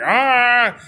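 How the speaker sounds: high drawn-out mock cry; mimicked pain cry. Retelling the jumper breaking his legs, mimicked pain cry. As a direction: imitate a man crying out in pain.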